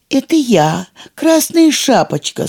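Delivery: put-on wolf faking a high little girl's voice; thin girlish voice. A narrator's reading. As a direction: squeak it out like a small girl. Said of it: "тоненьким голоском девочки".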